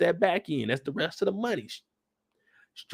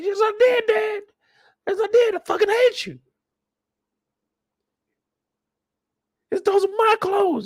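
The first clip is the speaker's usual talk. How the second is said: much higher, shouting mock teen; furious son. Son screaming hatred at his father, high shout. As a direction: yell it at him.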